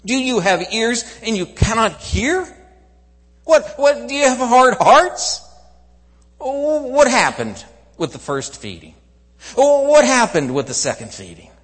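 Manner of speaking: loud, high, incredulous questioning; exasperated rebuke. Acted. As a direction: hammer the questions in acted exasperation. Jesus rebukes the dense disciples.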